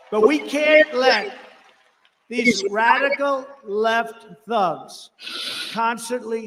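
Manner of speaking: drawn out and loud, voice high with outrage; slow loud outrage. Real, not acted. Rally crowd hears him slam the radical left, booming and slow. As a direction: lean hard into every word. Angry rally attack on opponents.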